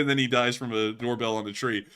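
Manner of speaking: usual speaking voice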